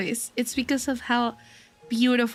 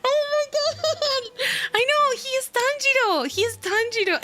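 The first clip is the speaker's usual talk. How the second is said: high-pitched giddy fangirl outburst; flustered swooning; 10 semitones higher than usual. Real, not acted. Fan blushing over Tanjiro's voice actor, high flustered squealing. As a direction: gush helplessly, pitch way up.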